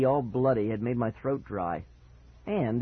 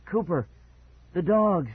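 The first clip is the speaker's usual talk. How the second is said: higher-pitched, struggling to talk; weak croak; 5 semitones higher, 4 dB louder than usual. Acted. Hurt brother gasps out a warning, weakly croaked. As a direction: croak it as if barely able. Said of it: "barely able to talk".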